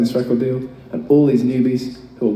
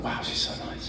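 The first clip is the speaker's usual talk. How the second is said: whispered laughing aside; amazed aside; 14 dB quieter than usual. Retold or imitated. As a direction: breathe it out in giggling disbelief. Amazed aside about [name].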